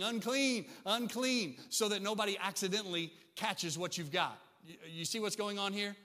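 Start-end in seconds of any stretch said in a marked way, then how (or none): 0.0-1.6 calling out unclean, higher and louder; leper's call, raised and pitched up. Retold or imitated. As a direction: warn the street as a leper. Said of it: "walk around saying".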